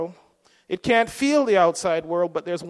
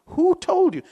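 God asking; 10 semitones higher than usual. Retold or imitated. God questions Adam and Eve.

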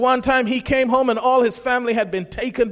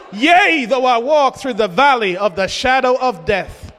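ringing proclamation; 3 dB louder than usual. Retold or imitated. Preacher declaiming David's psalm to the congregation, ringing proclamation. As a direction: cry out the opening, then carry it forcefully.

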